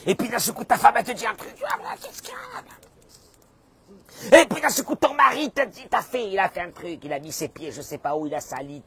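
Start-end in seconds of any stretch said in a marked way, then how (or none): 0.0-1.5 sudden agitation. Acted. Acts out sudden flare at wife. 1.6-2.6 strained, voiceless high rasp; irritated snap. Acted. Acts out husband snapping at wife. 4.4-7.0 sudden agitation. Acted. Acts out wife's sudden irritation.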